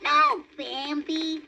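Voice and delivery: high little rabbit asking; baby rabbit voice. A narrator's reading. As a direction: voice a small eager bunny. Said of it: "said Thumper".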